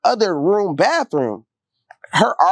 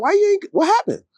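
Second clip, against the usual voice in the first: higher concerned voice, quick question; a much higher voice asking what went wrong; concerned girlfriend voice. Retold or imitated. Girlfriend asking why he never came back, higher and concerned. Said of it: "She was like".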